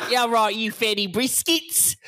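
Cockney accent. Shown as a demonstration. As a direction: put on a drawn-out, high-pitched Cockney. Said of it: "you have to say it in a Cockney accent".

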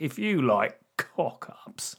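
slow, drawn-out presenter voice, unlike his own; Dennis Norden impression. Impersonating Dennis Norden introducing bloopers, slow and plummy. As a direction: savour every word like an old TV host. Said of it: "Dennis Norden with using phrases like".

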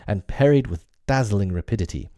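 ordinary delivery, nothing marked

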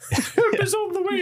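put-on high laughing old nanny voice; Mrs. Doubtfire. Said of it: "I just have to sound like Missus Doubtfire".

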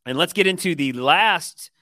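ordinary talk

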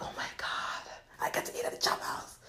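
hushed, whispered awe; breath-only reverent whisper; awed whisper. Retold or imitated. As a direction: whisper it like a starstruck diner. Past self awed by fancy restaurant.

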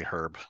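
"herb" with the H pronounced; British sounded H. Shown as a demonstration. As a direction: hit the H at the start of "herb". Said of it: "because of the fucking H in it".

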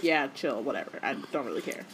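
lazy, indifferent McGonagall; bored dismissal. Retold or imitated. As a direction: shrug it off, barely bothering. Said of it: "And McGonagall's like".

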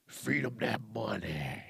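low, slow, hushed greedy voice; softly intoned creepy demand; mock sinister. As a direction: drop low and draw it out, mock-menacing. Mock voice of money-hungry carmakers.

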